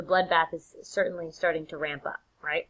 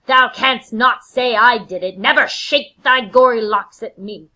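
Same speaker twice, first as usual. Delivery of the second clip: loud, slow, theatrical breakdown yell; 4 semitones higher, 12 dB louder than usual. Acted. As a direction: hurl the accusation in a public meltdown. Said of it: "He starts yelling".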